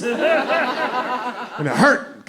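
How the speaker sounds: ordinary talk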